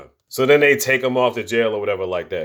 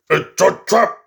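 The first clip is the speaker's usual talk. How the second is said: higher and louder, trap rising; shouted alarm; 6 semitones higher, 3 dB louder, slower than usual. Retold or imitated. Quoting the movie line as a loud, alarmed shout. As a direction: call out the warning.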